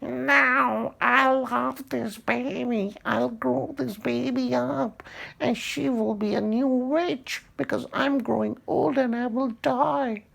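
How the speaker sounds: high, possessive witch voice. Acted. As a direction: clutch the baby and plead, high and sly. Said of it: "And the witch like".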